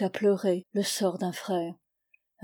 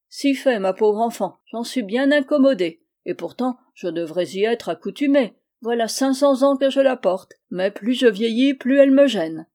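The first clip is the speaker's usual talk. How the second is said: put-on raised, louder old-man character voice; complaining little old man; 3 semitones higher, 6 dB louder than usual. Little old man complaining of his beard, put-upon. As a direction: grumble in character about a long-standing bother.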